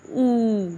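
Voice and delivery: "او" held long as a steady oo; lengthened, even "او"; stretched vowel. Shown as a demonstration. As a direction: draw out "او" without breaking it. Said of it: "اس کو آپ نے کھینچ کے پڑھنا ہے".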